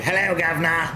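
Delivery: hammed-up Cockney-style English greeting; mock English accent. Husband performing on his English wife's request, mock English accent. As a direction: do a deliberately bad English accent. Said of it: "do your English accent".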